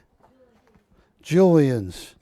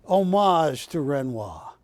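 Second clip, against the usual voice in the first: ordinary delivery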